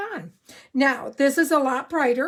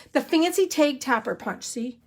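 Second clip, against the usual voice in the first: ordinary talk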